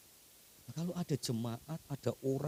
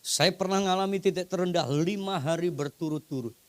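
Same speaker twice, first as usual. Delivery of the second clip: raised testimony; 4 semitones higher, 6 dB louder than usual. Acted.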